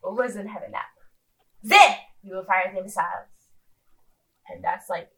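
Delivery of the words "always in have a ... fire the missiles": lightly imitated French accent; amateur mock French, hushed then punching up; bad French accent. Host quoting the silly video, bad French accent. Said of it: "do an actual French accent".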